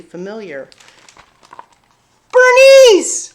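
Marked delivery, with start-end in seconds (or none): 2.3-3.3 high, loud excited shout of a name; joyful cry. A narrator's reading. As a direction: call out the name in pure delight. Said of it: "Sophie cried".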